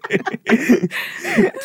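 high giggling laugh; bursting into a high laugh; high burst of laughter. Real, not acted. Laughing at her own story.

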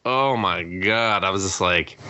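usual speaking voice

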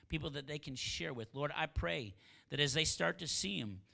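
rising, fervent pastor's prayer; preaching prayer. Acted. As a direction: pray it out over the congregation with building force. Leads the closing prayer.

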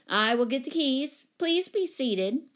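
loud, high, stately order; commanding lady. Acted. Grand tenant seating her visitors, commanding. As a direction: announce it grandly.

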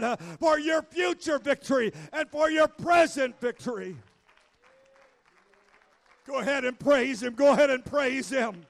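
loud, rising shouted preaching; preaching at full force. Acted. Pastor rallying the church to stand and praise, full-force preaching. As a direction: whip the congregation up to praise. Urges congregation to praise God.

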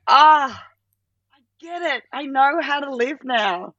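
high gasp of sudden insight; revelation. Retold or imitated. Staring at magic eye puzzle, sudden high realisation. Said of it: "you go".

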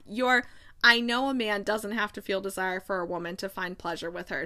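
ordinary delivery, nothing marked

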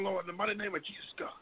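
usual speaking voice

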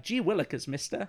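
lightly voiced golly-gosh kid; wholesome 1950s kid. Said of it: "It's very like".

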